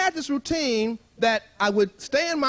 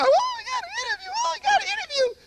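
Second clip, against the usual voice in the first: much higher calling out the news; excited call. Retold or imitated. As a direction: call the others in, high and excited. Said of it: "comes out and waves".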